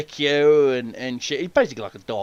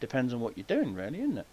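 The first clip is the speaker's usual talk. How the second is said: much softer sly remark; 5 semitones lower, 11 dB quieter than usual. Retold or imitated. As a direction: say it low and knowing. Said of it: "I'm like".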